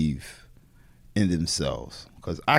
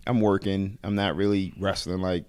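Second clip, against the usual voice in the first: ordinary delivery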